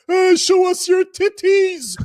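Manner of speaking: put-on drunk frat boy, high and loud; hollering tipsy frat boy; frat bro. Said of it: "he sounded like a, a frat boy".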